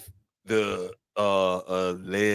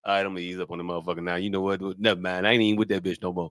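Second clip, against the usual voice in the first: fast; 3 semitones lower, 3 dB quieter, much faster than usual. Retold or imitated. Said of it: "you be like".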